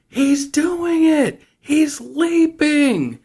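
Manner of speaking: high, drawn-out panicked yell, hammed up; mock scream. [name] reports to Al over the car speakers, mock screaming. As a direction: stretch each word out in a high imitation scream.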